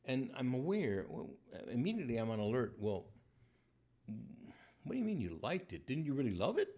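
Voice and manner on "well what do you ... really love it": ordinary delivery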